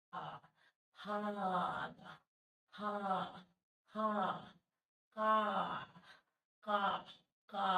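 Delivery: "আ" held with a fully stretched mouth; wide-open "আ". Shown as a demonstration. Teacher demonstrating the full vowel, wide-open "আ". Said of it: "প্রসারণ করে দিচ্ছি মুখটা".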